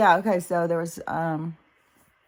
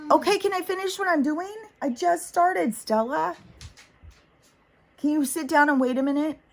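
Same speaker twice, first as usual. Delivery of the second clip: much higher, fast exasperated scolding; scolding the dog; 10 semitones higher than usual. Real, not acted. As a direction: tell the dog off, pitched way up. Scolding her dog Stella.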